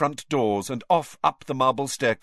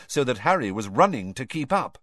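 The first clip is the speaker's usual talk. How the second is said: usual speaking voice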